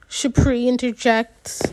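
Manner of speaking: plain reading voice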